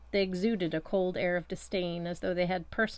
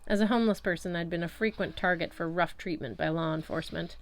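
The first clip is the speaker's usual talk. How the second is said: plain reading voice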